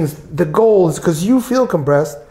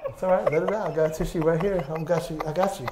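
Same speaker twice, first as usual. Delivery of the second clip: hushed, higher comforting voice; soft reassurance; 3 semitones higher, 9 dB quieter than usual. Real, not acted. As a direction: soothe gently, much softer. Practitioner comforting overwhelmed, tearful client.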